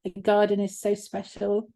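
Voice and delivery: ordinary talk, nothing marked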